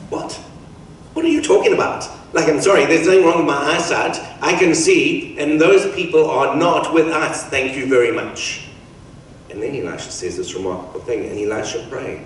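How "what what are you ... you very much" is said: loud, higher-pitched mock disbelief; acted incredulity. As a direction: protest like a baffled, indignant servant. Servant doubts Elisha's reassurance.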